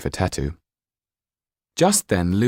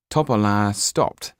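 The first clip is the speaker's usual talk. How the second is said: plain reading voice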